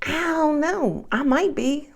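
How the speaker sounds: high, soft, hedging answer; unsure self-reply. Acted. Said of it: "come to Jesus talk with myself".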